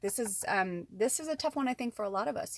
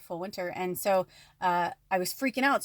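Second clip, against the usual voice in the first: ordinary talk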